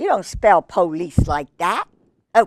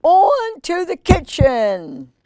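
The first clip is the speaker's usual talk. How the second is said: higher, slow gloating fox call; triumphant cry; 8 semitones higher, 4 dB louder, slower than usual. Acted. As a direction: crow it out in victory. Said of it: "and cried".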